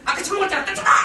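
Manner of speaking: 높고 크게 버럭 짜증내는 엄마; 기분 나쁜 엄마가 쏘아붙이듯; moody mom snapping. Retold or imitated. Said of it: "근데 이렇게 나오죠". (기분 나쁜 엄마가 아이에게 높게 버럭)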